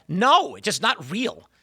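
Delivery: ordinary delivery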